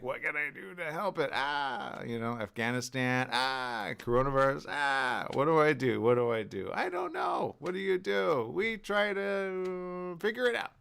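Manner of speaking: high frantic questions; fretting about world problems; hammed-up worry; mock panicked self. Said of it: "and you're like".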